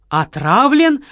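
can't believe the word; asked back in shock. A narrator's reading. Marquis and Kamila echo Lola in shocked disbelief. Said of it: "в один голос переспросили".

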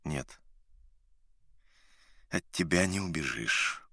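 soft, low, doomed tone; resigned. A narrator's reading. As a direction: say it with a note of doom. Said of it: "нотка обречённости".

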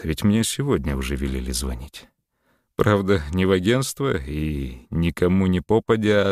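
usual speaking voice

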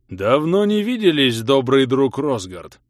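big grim's greeting. Acted. Giant grim greets the hero.

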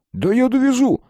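high, loud, eager exclamation; eager offer. A narrator's reading. Колян overhears and offers a ride, eager and high. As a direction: jump in keenly, pitch soaring.

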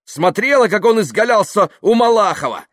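loud, high, incredulous tirade; ranting. Acted. Yuklin urging Dasha not to believe the party-goer, ranting. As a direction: shout with mounting scorn.

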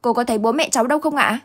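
high, fast anxious asking; boy hurriedly asks the neighbour about his parents; hurried question. A narrator's reading. Boy asking the neighbour where his parents are, hurried. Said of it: "lật đật hỏi".

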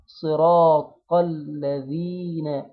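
صراط الذين drawn out, tongue out a little for the ذ; slow recitation. Shown as a demonstration. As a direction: recite صراط الذين slowly, tongue tip showing on the ذال. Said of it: "هنخرج لساننا شوية".

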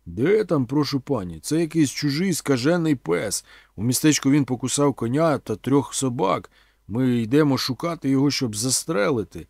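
loud, urgent warning; emphatic policeman. A narrator's reading. Policeman to a woman about a rabid dog, loud and emphatic.

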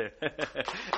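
a quiet ingratiating chuckle; small fawning laugh; soft laugh. Acted. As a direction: laugh softly to flatter. Sycophant laughs softly.

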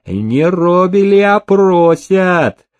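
high, drawn-out mockery; scornful sneer, stretched at the end; snide. A narrator's reading. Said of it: "ехидно усмехнулся Никита".

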